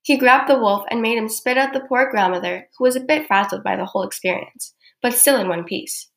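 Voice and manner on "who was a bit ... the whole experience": plain reading voice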